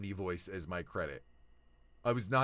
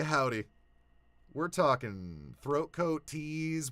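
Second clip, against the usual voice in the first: ordinary delivery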